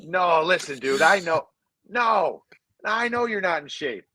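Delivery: high, excited sales pitch with a laugh in it; hammed-up Morasti pitch. Teammate on the phone selling a quick-cash job, high and giddy. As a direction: teammate talking a buddy into easy cash, voice pushed high and giddy.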